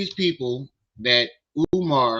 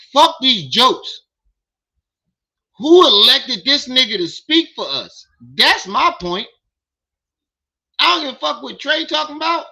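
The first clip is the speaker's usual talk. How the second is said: higher and louder, worked-up yelling; fired-up indignant ranting; heated rant; 8 semitones higher, 5 dB louder than usual. Real, not acted. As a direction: vent at the pastor's claim, building anger. Heated rant about representation.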